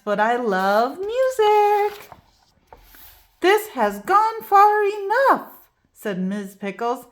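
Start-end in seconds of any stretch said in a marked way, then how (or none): 0.0-2.1 delighted. A narrator's reading. Bridget delighted by music. 3.4-5.5 exasperated. A narrator's reading. Teacher fed up with chaos.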